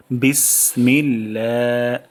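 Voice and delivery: বিসমিল্লাহ drawn out slowly, syllable by syllable; slow recitation demo. Shown as a demonstration. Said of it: "প্রথম শব্দটি হলো". (ধীরে টেনে তেলাওয়াত)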